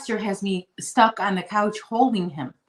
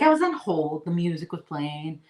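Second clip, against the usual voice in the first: ordinary talk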